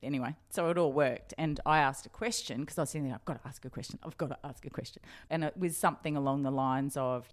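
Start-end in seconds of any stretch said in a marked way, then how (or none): 3.1-5.0 softly muttered nerves before asking; nervous mutter to self. Retold or imitated. Reporter psyching herself up at the press conference, quiet and nervous. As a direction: urge yourself on under your breath.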